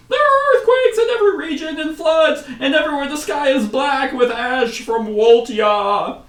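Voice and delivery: loud, very high-pitched alarm; frantic cry. Acted. Hunters reporting earthquakes and floods, frantic high shouting. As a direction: wail the disaster report in a high voice. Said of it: "they say frantically".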